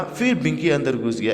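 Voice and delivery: ordinary delivery, nothing marked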